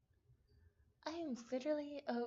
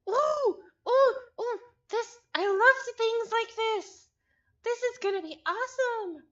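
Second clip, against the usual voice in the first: loud, high, thrilled gushing; excited squeals; 7 semitones higher, 12 dB louder than usual. Real, not acted. Streamer gushing to chat about the puzzle, thrilled. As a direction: bubble over with delight.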